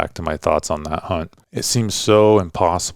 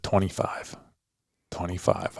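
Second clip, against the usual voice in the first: breathy whispered yardage calls, much softer; retold whisper; 8 dB quieter than usual. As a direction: whisper the range to the shooter. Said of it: "I just kept whispering".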